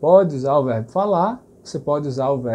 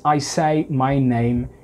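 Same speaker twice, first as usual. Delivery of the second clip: slow, word by word; teacher saying the English sentence slowly; slow English example; 2 semitones lower, much slower than usual. Shown as a demonstration. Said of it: "Em inglês".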